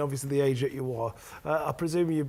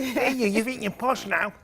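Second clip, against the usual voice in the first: slow, higher mock-Salford taunt with a laugh; teasing Salford local; 5 semitones higher than usual. Host voicing Salford folk back home, mocking Salford accent. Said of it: "I get it myself".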